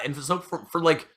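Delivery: ordinary delivery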